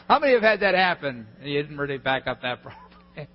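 talking through a laugh; real laughter. Real, not acted. Preacher asking congregation who lost data, laughing as he speaks. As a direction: let the laugh break into the words. Laughing after the shingles anecdote.

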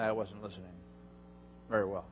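ordinary delivery

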